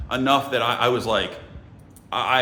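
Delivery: ordinary delivery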